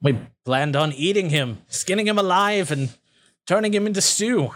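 a hint of a gloating goblin; amused goblin leader. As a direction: relish the cruel plan. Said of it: "He kinda chuckles and he says".

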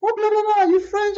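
very high, loud imitation of an eager man; excited imitation. Man finding out his dance partner speaks French, excited imitation. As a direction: squeal it like an excited new acquaintance.

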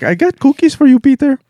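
high, loud sweet mock voice; mock landlord's daughter.

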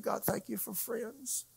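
high, soft, choked-up thanks; weeping prayer, retold. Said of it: "I, I wept".

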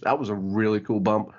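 usual speaking voice

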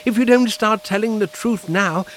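ordinary talk, nothing marked

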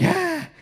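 high, squeezed mock "ah"; hammed-up anxious yelp; nervous cry. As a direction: squeal with comic dread. Retold panic, being made to sweat.

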